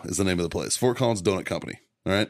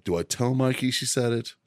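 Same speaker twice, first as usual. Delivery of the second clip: ordinary talk, nothing marked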